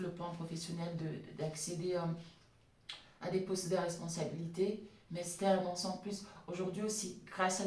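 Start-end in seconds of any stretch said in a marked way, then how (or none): none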